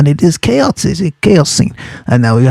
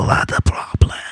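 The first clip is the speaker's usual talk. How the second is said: whisper; 6 dB quieter than usual. Acted. Teacher stressing theme, whispered.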